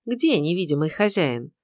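usual speaking voice

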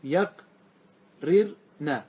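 "يقررن" very slowly as yaq... rir... na; syllable by syllable. Shown as a demonstration. Teacher dissecting the verb for students, "يقررن" syllable by syllable. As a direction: break "يقررن" into three separated beats, yaq, rir, na. Teacher splits the word again.

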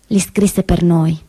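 normal talking voice

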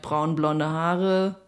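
usual speaking voice

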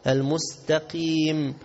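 slow "المستقيم" with a thin t; correct light ta. Shown as a demonstration. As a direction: recite "المستقيم" without thickening ت. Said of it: "خلي الت طا". («المستقيم» بتاء خفيفة)

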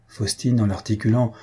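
usual speaking voice

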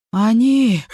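gasping the word out, loud and high; panting between words; out of breath. A narrator's reading. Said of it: "Он часто и громко дышал".

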